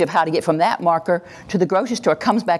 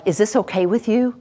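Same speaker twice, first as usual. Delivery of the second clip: higher, permission-seeking question; passive; 7 semitones higher than usual. Shown as a demonstration. Trainer voicing what passive speech implies, seeking permission. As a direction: ask it tentatively, pitched up. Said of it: "a passive voice has at the end".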